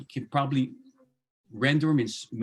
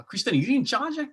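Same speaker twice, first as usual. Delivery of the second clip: teasing call across the room, higher and a bit louder; playful Pidgin call-out; 11 semitones higher, 4 dB louder than usual. Shown as a demonstration. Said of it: "We say".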